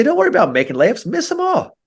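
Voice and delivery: a light send-off, like it's a fun dare; cheerful coach urging. Retold or imitated.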